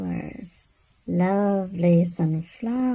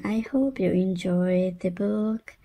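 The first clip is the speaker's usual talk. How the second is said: plain reading voice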